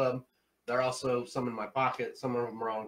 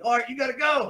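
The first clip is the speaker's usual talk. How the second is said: mock call; 11 semitones higher, 8 dB louder than usual. Staff calling him back.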